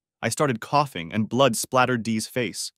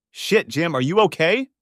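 higher, louder alarmed ask; alarmed question; 7 semitones higher, 5 dB louder than usual. Acted. Dave sees his battered friend, alarmed and loud. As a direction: Dave reaches out in shock. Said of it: "asked Dave".

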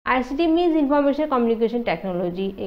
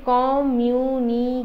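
Communication slowly stretched into separate syllables; much slower than usual. Shown as a demonstration. Teacher breaking the word for students, communication slow and segmented. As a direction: spell out the beats of communication. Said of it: "ইয়াতে পাঁচটা syllable হ'ব".

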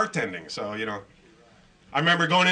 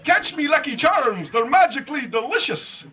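high, lilting cartoon Irish leprechaun; sing-song mock-Irish cereal pitch; terrible Irish accent; 7 semitones higher than usual. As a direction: do a hammed-up, bad Irish brogue. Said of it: "the worst Irish accent you ever heard".

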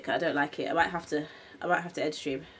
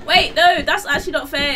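loud protest; 6 semitones higher, 9 dB louder than usual. Real, not acted. Protesting the dinosaur attack.

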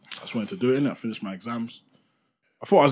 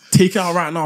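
higher, louder stern dad command; mock dad order, louder; angry dad; 7 semitones higher, 9 dB louder than usual. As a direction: bark it like an angry father. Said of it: "He's like".

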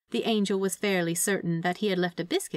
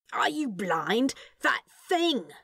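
higher, slow irritated snap; snapping; 5 semitones higher, slower than usual. A narrator's reading. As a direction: jab the words at him. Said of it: "Crowley snapped".

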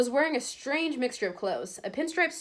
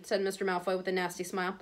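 ordinary delivery

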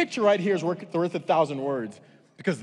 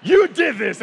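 loud, high comic blame; mock-furious outburst; accusing shout; 9 semitones higher, 12 dB louder, slower than usual. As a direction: hurl the blame at a companion. Acted blame shouted at companion.

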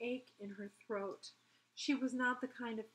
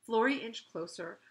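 ordinary delivery

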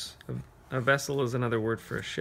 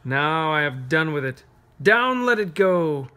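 dramatic poem reading; 5 dB louder than usual. Acted. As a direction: knock the town over with the words. Reads poem to children.

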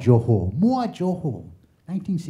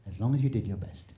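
ordinary talk

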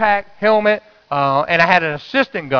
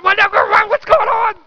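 high, alarmed question; bewildered. Retold or imitated. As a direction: cry out in confused shock, pitched up. Confused when led to the hole.

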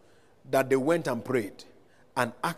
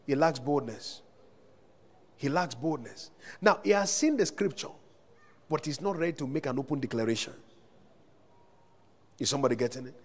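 ordinary delivery

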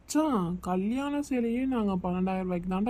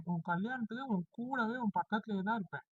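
much softer, sincere reassurance; quiet promise; 11 dB quieter than usual. Acted. Groom's friend promising to be at his wedding, softly sincere. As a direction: hush it like a vow to your best friend.

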